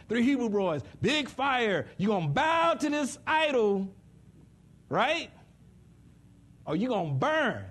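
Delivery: loud, high preaching build. Acted. Preacher telling the Hebrew boys story at full force. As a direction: bring the fire and brimstone.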